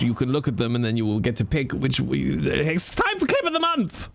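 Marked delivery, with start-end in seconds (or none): 2.4-4.1 announcer. Pretend. Streamer introducing the clip of the month, announcer cry. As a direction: herald the segment in a high, ringing call.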